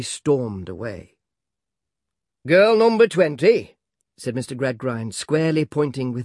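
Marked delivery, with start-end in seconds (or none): 2.5-3.8 louder, higher call across the classroom. A narrator's reading. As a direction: summon the pupil sharply. Schoolmaster calls on a pupil.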